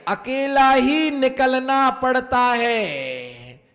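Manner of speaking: slow emphatic maxim. Shown as a demonstration. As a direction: stretch every word for weight. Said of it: "समझ में नहीं आया?".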